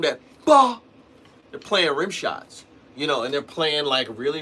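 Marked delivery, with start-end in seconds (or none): 0.4-0.8 loud, high, explosive drum crack; rim shot imitation. Shown as a demonstration. Drummer demonstrating the pro snare tone, explosive crack. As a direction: belt out a snare hit with the voice. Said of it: "the way they get that tone".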